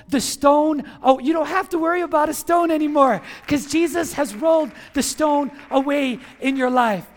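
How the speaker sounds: loud, driving sermon climax; loud, urgent proclamation; impassioned preaching. Real, not acted. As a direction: press it home forcefully to the congregation. Preacher builds to encouraging climax.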